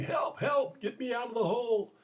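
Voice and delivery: high-pitched mock cry for help; retold yell for help. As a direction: yell like a man stuck in a hole, half in earnest. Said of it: "he's yelling".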